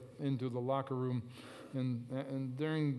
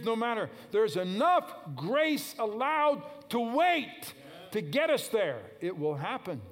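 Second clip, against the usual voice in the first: preaching at full force; 7 semitones higher, 4 dB louder than usual. Acted. Preacher urging his congregation not to give up, at full force. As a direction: drive each phrase home to the church.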